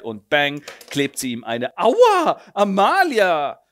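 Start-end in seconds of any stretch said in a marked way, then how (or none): 1.8-3.6 high loud wounded whine; a slapped ham actor wailing; hammed-up yelp. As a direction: yelp then whine her name slowly. Slapped man yelps.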